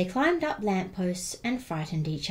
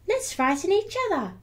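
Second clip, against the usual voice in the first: much higher little skeleton voice; 12 semitones higher, 3 dB louder than usual. Acted. Little skeleton with a playful idea, very high. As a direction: squeak it with glee like a small child. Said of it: "he said".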